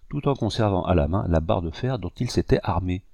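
ordinary delivery, nothing marked

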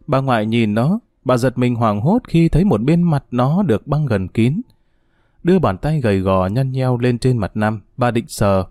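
ordinary delivery, nothing marked

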